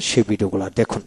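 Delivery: ordinary talk, nothing marked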